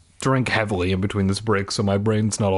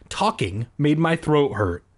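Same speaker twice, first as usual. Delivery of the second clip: ordinary delivery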